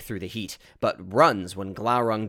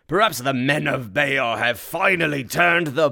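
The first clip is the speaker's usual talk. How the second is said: raised, projected character voice; battle dialogue; 6 semitones higher, 9 dB louder than usual. Acted. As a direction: declaim it loudly over a battle. Battle dialogue cut off by blast.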